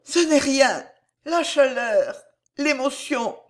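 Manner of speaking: high, halting, weakened voice; strained high voice, pausing between phrases; faint stammering. A narrator's reading. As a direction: murmur it in broken phrases, coming round from a faint. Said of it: "reprenait ses sens et balbutiait".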